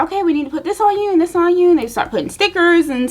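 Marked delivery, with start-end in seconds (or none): 0.0-1.7 hurried nurses. Retold or imitated. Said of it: "they're like".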